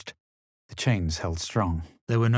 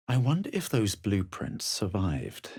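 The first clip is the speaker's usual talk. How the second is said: ordinary talk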